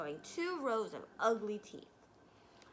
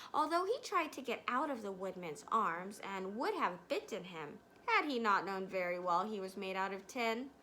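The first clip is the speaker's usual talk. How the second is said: usual speaking voice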